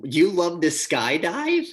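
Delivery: high, slow surprised question; mock astonishment at her answer.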